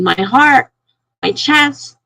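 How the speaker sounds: mild mock yell of pain; retold yelling. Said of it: "and I'm yelling".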